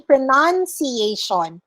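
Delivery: pronunciation said as "pro-nun-see-ay-shun"; pronunciation modeled syllable by syllable; noun form. Shown as a demonstration. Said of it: "If it's the noun".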